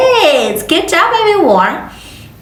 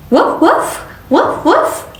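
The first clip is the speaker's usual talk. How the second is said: dog bark; 6 semitones higher than usual. Shown as a demonstration. Teacher imitates dog.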